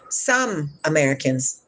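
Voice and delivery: "Some" held long and set apart; stress on Some. Shown as a demonstration. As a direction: lean on the word "Some" before moving on. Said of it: "Use the word some".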